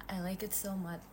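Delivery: whispered to herself, voiceless. Real, not acted. To viewers about avocado coffee, whispered. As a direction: breathe it out like a secret.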